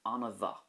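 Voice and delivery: Anna bha with mh as a V, anna va. Shown as a demonstration. Teacher showing learners bha with a V sound. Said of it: "This is a V sound as well".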